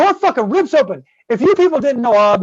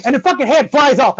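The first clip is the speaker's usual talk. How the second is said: ordinary talk, nothing marked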